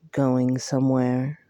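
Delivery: a fae lord cutting her off, low; Tamlin, low and uncanny, stopping her in the hallway; otherworldly Tamlin. A narrator's reading. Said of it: "Tamlin asked".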